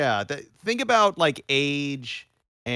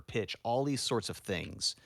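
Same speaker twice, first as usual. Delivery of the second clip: ordinary talk, nothing marked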